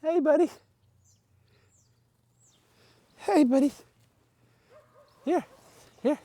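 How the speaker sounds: talking to a dog. Real, not acted. Calling to dog, higher pitch.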